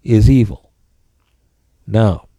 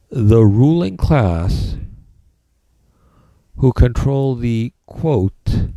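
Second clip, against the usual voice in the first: dictating; slower than usual. Shown as a demonstration. Dictating notes to the class.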